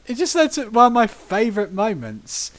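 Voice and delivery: usual speaking voice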